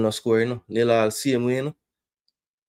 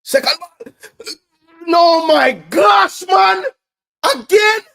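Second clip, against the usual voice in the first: very high, loud strained cry; real despairing fan shout; anguished shout; 12 dB louder, slower than usual. Real, not acted. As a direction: scream in disbelief at the miss. Anguished shout at missed chance.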